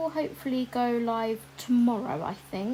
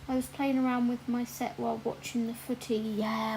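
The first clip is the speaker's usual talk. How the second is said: ordinary delivery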